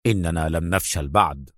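low, contained rebuke; restrained anger without shouting; angry Adham. A narrator's reading. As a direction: rebuke firmly in a deep voice. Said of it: "صاح به أدهم في غضب".

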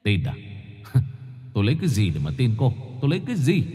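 husband scorning his wife's denial; increasingly harsh husband. A narrator's reading. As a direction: sneer at her excuses, building heat.

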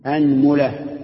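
أنملة as "anmula", a on the hamza, u on the meem; a then u. Shown as a demonstration. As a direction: say أنملة clearly as AN-mu-la. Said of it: "افتح الهمزة".

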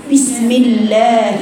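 بسم الله drawn out with a clipped lam fatha; slow wrong reading. Shown as a demonstration. Teacher shows a mistaken بسم الله. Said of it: "لا، لا".

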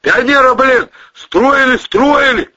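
loud, high, drawn-out scolding; outraged high yell; indignant shouting. A narrator's reading. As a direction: rant at the driver who wrecked it. Acted shouted scolding in story.

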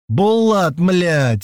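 high, exasperated shout. A narrator's reading. Man pinned by his beast, shouting. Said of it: "закричал я".

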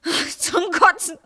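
slow, high, tearful groan; anguished disgust. Acted. Wife sick of their life, anguished disgust. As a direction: drag it out, close to crying.

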